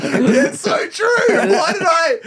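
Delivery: slow, high laughing words breaking into a shout; helpless laughter rising to a cry; real laughing wail at own stupidity. Real, not acted. Cracking up at own foolishness.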